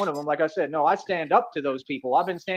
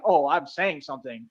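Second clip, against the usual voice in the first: mocking voice; 5 semitones lower, slower than usual. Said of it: "Sit there and act like".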